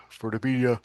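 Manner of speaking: talking through a yawn; words stretched by a yawn. Real, not acted. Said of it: "Sorry, I'm yawning here".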